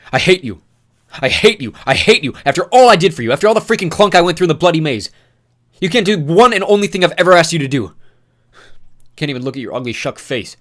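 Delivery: loud, high repeated hate; furious ranting. Acted. Newt raging at Thomas, loud furious yell. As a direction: hammer each hate at him.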